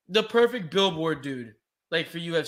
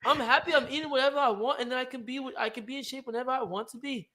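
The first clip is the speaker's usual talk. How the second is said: put-on higher-pitched Scouse accent; Patty the Batty; 5 semitones higher than usual. Podcaster playing fighter defending his diet, put-on Scouse accent. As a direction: imitate a Liverpool fighter, lilting Scouse. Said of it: "the Scouser. It's the Scouser".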